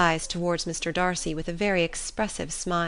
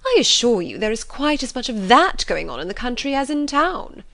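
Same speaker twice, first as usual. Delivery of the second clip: higher, louder indignant mother; offended; 6 semitones higher, 6 dB louder than usual. A narrator's reading. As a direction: protest in a huff, swooping in pitch. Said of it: "offended by his manner".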